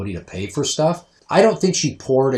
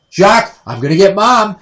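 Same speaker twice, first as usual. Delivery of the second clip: high, loud tease to a dog; loud mock threat; taunting call; 10 semitones higher, 12 dB louder than usual. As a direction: imitate calling out to wind up the dog. Teasing the protective dog.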